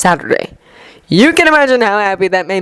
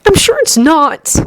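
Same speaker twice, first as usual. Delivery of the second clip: put-on gentle young man voice; 10 semitones higher than usual. A narrator's reading. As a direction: voice Izuku lightly higher, kindly. Izuku voiced by narrator.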